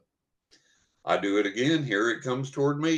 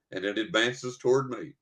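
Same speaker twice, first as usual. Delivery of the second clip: ordinary talk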